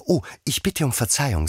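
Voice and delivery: high apology at normal volume; high, contained apology; Alice apologising. A narrator's reading. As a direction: say sorry in a high voice, without calling out. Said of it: "sagte Alice wieder".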